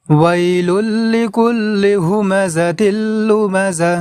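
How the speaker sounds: slow, long-held melodic tilawat; Quran recitation. Shown as a demonstration. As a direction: chant the whole surah with long held notes. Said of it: "আর একবার তেলাওয়াত করব". (পুরো সূরা ধীর সুরেলা তেলাওয়াত)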